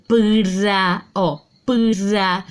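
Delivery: "pra" said slowly with clear P and R; syllable pra sounded out. Shown as a demonstration. Teacher to pupils, sounding out "pra" clearly. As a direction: sound out "pra" so both consonants ring. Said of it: "Eu escuto o som do P".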